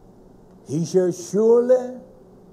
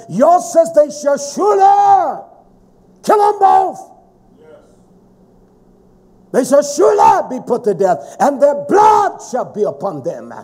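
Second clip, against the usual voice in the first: preaching shout; 4 semitones higher, 3 dB louder than usual. Real, not acted. Preacher thunders the death penalty verse at the congregation, shouting. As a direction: hammer the verdict home from the pulpit.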